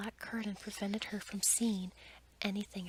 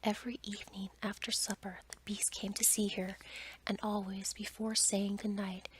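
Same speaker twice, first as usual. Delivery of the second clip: ordinary delivery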